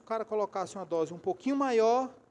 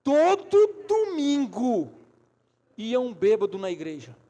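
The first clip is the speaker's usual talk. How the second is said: slow, high shouted TODO DOMINGO, easing down after; booming, drawn-out exasperated emphasis; loud emphatic repeat; 6 semitones higher, 11 dB louder, slower than usual. Real, not acted. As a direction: bellow it to the congregation, stretching each word.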